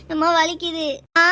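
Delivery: loud, whining complaint of pain; pained cry. Acted. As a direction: cry out to mum that it hurts. Punished child complains of pain to mother. (வலியில் கத்திச் சிணுங்கும் குரல்)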